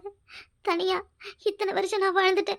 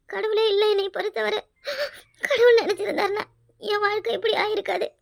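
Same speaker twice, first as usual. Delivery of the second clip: normal talking voice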